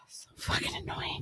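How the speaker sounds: sighed-out, whispered grumble; exasperated whisper. Real, not acted. Customer venting to friend about drink, whispered exasperation. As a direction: breathe the complaint out, barely voiced. Venting under her breath.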